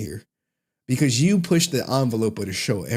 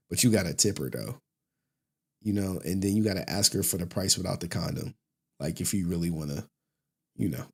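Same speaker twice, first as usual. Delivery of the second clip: imitating Snow Bunny heaven's reply; 5 semitones lower, 5 dB quieter than usual. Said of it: "It was like".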